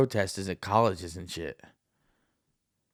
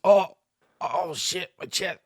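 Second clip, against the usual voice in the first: high, loud mock gasp of pain; sudden high cry then strained wince; fake heart attack; 8 semitones higher, 3 dB louder, slower than usual. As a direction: ham up clutching the chest. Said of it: "he goes".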